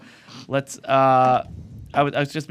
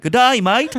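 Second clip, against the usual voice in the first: high, loud put-on Aussie; Australian accent; 9 semitones higher, 5 dB louder, slower than usual. Shown as a demonstration. Podcaster shows how to sound Australian, loud high Aussie accent. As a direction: belt out a hammed-up Australian greeting. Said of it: "If I wanna sound like Australian, I'd be like".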